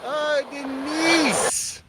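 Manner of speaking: soft, slow and high, moved by the donation; touched sigh. Real, not acted. Streamer to donor Denise, slow and softly touched. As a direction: breathe her name out, touched.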